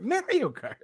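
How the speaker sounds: high laughing repeat. Real, not acted. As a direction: giggle through the word. Repeating word while laughing.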